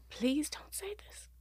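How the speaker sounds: high, slow, breaking into whisper; retold plea, soft and drawn out; desperate pleading. Retells begging before hearing the death.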